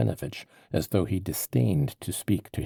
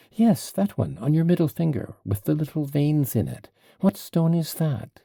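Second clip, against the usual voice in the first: put-on pitched higher, whiny and insistent like a sulky child; peevish child; 8 semitones higher, 5 dB louder than usual. A narrator's reading. Mitya pesters the investigator about his ring, peevishly higher. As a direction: needle him like a peevish child. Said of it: "like a peevish child".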